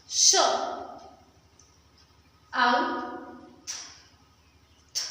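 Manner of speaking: साउथ split as स, आउ, थ, थ; sounding out. Shown as a demonstration. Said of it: "इसका प्रोनाउंसिएशन क्या होगा?".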